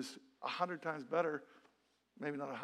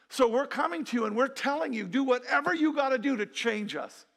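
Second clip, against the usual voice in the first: loud, raised-pitch plea to God; impassioned plea; 6 semitones higher, 6 dB louder than usual. Retold or imitated. Husband and wife praying over their horrible marriage, pleading forcefully. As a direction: re-enact an urgent, insistent prayer. Acted desperate prayer of couple.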